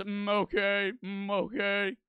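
drawn-out nasal-hummed catchphrase impression; Mister Mackey. Streamer trying out the requested character, impression voice. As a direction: put on a cartoon character's humming catchphrase. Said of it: "Mister Mackey, what do, what do you go".

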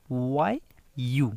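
ওয়াই ইউ drawn out slowly, y glide into you; slow, stretched ওয়াই ইউ showing the hidden y; teacher sounding ওয়াই ইউ out slowly for students; spelling out the sound. Shown as a demonstration. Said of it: "ইউ যদি আমি উচ্চারণ করি".

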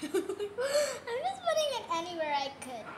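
giggling through the words; laughing. Real, not acted. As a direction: laugh while you speak. Laughing while applying highlight.

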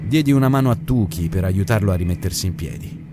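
ordinary delivery

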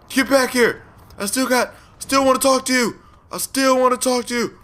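high calling after a runaway; mock chase call. Gamer calling out high to a fleeing pedestrian. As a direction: call out high, mock-pleading, while chasing.

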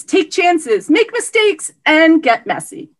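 high, rousing teacher catchphrase; Miss Frizzle. Acted. Award winner quoting the role she tries to embody, Miss Frizzle style. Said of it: "Miss Frizzle, that is the role".

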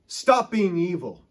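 slow, loud stern rebuke; a friend's blunt loud rebuke. Retold or imitated. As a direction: rebuke them slowly, loud and firm. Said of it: "That's the way he would rebuke you".

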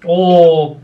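usual speaking voice

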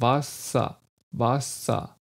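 WASSER ending in a weak A-like sound, no R; vocalic R. Shown as a demonstration. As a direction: say WASSER in two careful syllables. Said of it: "Das ist der Laut".

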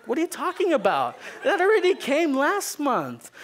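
high, surprised teacher voice. Retold or imitated. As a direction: act shocked in a sweet high voice.

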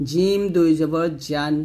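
জিম with a firm j from mid-tongue; slow, full j in জিম and জান; jīm sound. Shown as a demonstration. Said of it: "জিহ্বার মধ্যখান থেকে উচ্চারণ". (জিম জিহ্বার মাঝখান থেকে)

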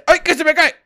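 high, loud yelp of alarm; sudden high panic; panicked cry. Real, not acted. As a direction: cry out as something slips from your hands. Baby slips from the player's hands.

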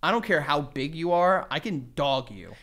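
normal talking voice

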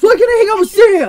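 put-on high, loud, fast teen whine laughing; whiny teen. Mother mocking her teenager, high laughing whine. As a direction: whine it like a sulky kid, laughing through it.

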